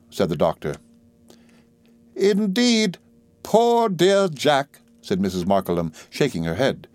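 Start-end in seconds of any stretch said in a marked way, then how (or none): 2.1-4.8 put-on high, slow, fluttery old lady voice; fussy old lady. A narrator's reading. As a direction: play a gushing elderly mother. Old soldier mother voiced.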